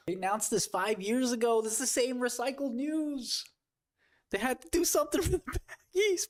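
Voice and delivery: very high whiny pleading; mock begging bagholder. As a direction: beg Trump in a squeaky desperate whine. Begging Trump as baggie.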